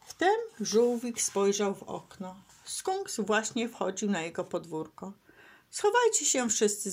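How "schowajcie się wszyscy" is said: higher-pitched warning call; urgent call. A narrator's reading. Turtle tells friends to hide, calling out urgently. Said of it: "zawołał Franklin".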